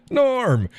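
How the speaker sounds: a big, high shouted welcome across the bar; cheerful bar-crowd shout of a regular's name; Cheers-style greeting. Retold or imitated. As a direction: call out his name like the whole bar knows him. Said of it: "I walk into places like Cheers".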